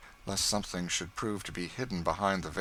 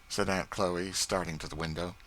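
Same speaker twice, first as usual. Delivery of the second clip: ordinary delivery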